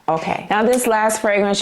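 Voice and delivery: ordinary talk, nothing marked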